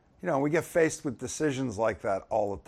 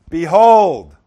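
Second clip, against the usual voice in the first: "Behold!" yelled out, much louder and higher; 6 semitones higher, 17 dB louder than usual. Shown as a demonstration. Preacher showing the congregation how emphatic the word is, yelled. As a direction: shout "Behold!" like waking a crowd. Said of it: "It's like if you yelled".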